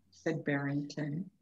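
plain reading voice

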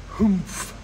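high, falling humph of scorn; disdainful humph. A narrator's reading. Sour kangaroo scoffing at the elephant, disdainful humph. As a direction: huff it out, high and dismissive. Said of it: "humphed a voice".

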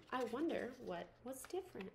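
usual speaking voice

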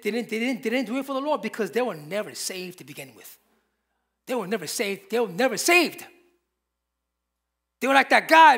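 ordinary delivery

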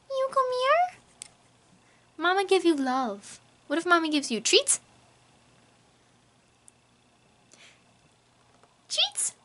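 high coaxing voice, rising on treats; baby talk to pet. Acted. Owner tempts her cat with treats, in high coaxing baby talk. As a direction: lure the cat in with a sing-song.

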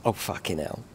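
ordinary talk, nothing marked

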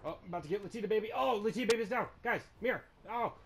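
high, loud battle yells; hyped shouting. Real, not acted. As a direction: scream with game hype. Hyped during a fight.

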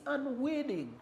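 UNWILLING pitched high for emphasis. Real, not acted. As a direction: underline UNWILLING by lifting the pitch. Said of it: "underline the word".

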